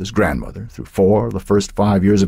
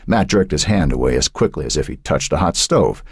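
usual speaking voice

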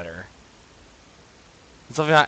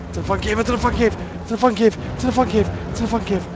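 repeated, much higher and a bit louder; rising panic; 10 semitones higher, 5 dB louder, much faster than usual. Real, not acted. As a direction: chant it in growing panic.

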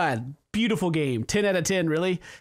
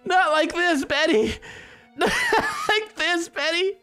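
mock anguish; 9 semitones higher, slower than usual. Mourning the dying Betty, hammed-up wailing. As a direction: wail her name in fake despair, half laughing.

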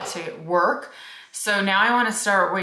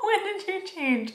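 high, soft, slow incredulous question; amused disbelief; 12 semitones higher, 5 dB quieter than usual. Retold or imitated. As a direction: ask half-laughing, stunned. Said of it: "I was like".

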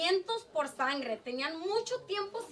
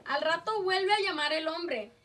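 ordinary talk, nothing marked

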